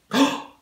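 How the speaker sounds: sharp, high intake of breath on "Oh"; imitated gasp. Agent gasps at the wrong boarding pass, imitated shocked gasp. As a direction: mimic her shocked gasp. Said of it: "just like that".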